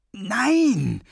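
high, loud drawn-out protest; startled cry of refusal; alarmed refusal. A narrator's reading. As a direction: recoil in fright at the offer. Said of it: "wehrte Thrasher erschrocken ab".

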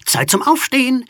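high, loud alarm-clock cry; wake-up call. A narrator's reading. Skull alarm clock wakes the sleeping ghost, high loud wake-up call. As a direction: call out loudly to rouse a sleeper.

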